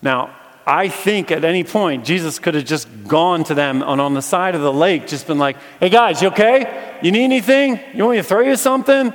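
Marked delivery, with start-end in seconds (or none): 5.8-9.1 loud, high holler across water; casual shout to friends far off; calling out. Pretend. Jesus calling to disciples out on the lake, calling out.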